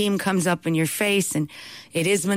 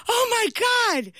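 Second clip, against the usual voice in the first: high, slow shocked exclamation; a husband's alarmed outcry; alarmed cry; slower than usual. Retold or imitated. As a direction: gasp it out in horror. Husband runs in, chair accident.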